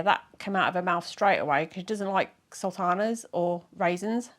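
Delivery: ordinary talk, nothing marked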